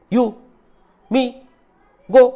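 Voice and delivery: "you", "me", "go" each said in one short burst, pauses between; one beat per word. Shown as a demonstration. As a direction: give "you", "me", "go" a single separate beat each. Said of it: "एक ही बार में आप जो बोल रहे हैं".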